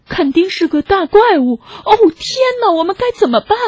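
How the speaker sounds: high-pitched panicky piglet; little pig panicking, pitch up; alarmed cry. A narrator's reading. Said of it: "小猪喊".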